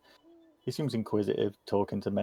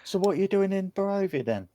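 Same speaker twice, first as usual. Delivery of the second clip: put-on higher-pitched child's question; curious kid; 10 semitones higher than usual. Kid questions travelers in the inn, curious child voice. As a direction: ask as a nosy youngster.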